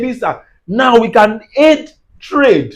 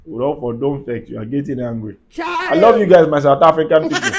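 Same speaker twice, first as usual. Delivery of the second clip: much lower and softer, calming tone; soothing; 8 semitones lower, 4 dB quieter than usual. Real, not acted. Telling the angry commenter not to vex, low and soft. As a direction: settle him down gently.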